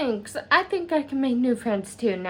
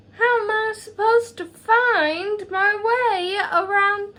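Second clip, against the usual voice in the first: fretting; 8 semitones higher than usual. Acted. Nervous character frets about moving.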